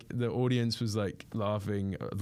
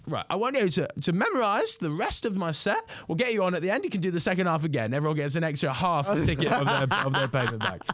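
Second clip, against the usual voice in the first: much higher announcing voice; comedian's offer; 7 semitones higher than usual. Retold or imitated. Comedian inviting the heckler on stage, high and upbeat. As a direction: make the offer in a raised stage voice. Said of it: "he was like".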